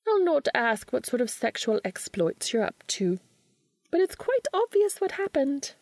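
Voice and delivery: high, arch witch voice; knowing and superior. A narrator's reading. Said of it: "Rowena says archly".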